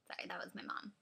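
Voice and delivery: soft unvoiced whisper. Real, not acted. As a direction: whisper the apology to camera. Aside right after phone call.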